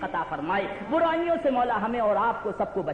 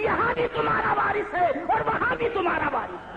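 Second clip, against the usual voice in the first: preaching at full force; 9 semitones higher than usual. Acted. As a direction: cry out the promise to the crowd. Climactic address to audience.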